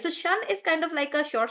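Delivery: usual speaking voice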